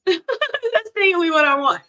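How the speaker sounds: giggly high repeat of the confession; laughing repeat. Real, not acted. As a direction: say it again, cracking up.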